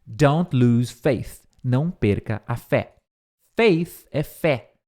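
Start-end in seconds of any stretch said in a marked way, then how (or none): 0.0-1.4 each word drawn out; slow clear teacher read; slow model line. Shown as a demonstration. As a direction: dictate it slowly. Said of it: "E aí ele diz".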